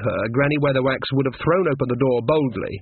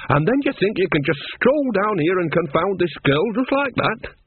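higher, exasperated telling-off; exasperated old woman; 6 semitones higher than usual. A narrator's reading. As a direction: scold her out of worry. Nanny scolds.

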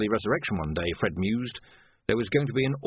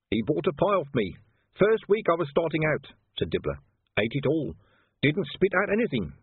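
higher, eager trader voice; Dibbler's voice; 7 semitones higher than usual. A narrator's reading. Dibbler remembering the dead copper, higher. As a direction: sell the memory like a pie.

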